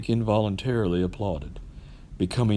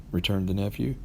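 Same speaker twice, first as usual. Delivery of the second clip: usual speaking voice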